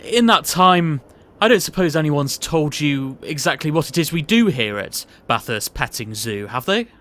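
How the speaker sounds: booming, jovial posh boss voice; pompous boss. Acted. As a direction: play the bluff, overbearing company boss. S0 plays the boss Mr Bathurst in the sketch.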